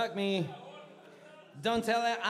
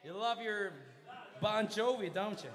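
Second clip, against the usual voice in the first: normal talking voice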